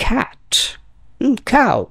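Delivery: cat and cow with a heavy খ sound; breathy K in cat, cow; cat, cow with aspirated K. Shown as a demonstration. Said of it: "সি কে in most of the cases খ বলবেন".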